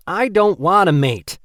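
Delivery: loud, high and slow refusal; angry shout. Acted. Rory shouting at Kerwin.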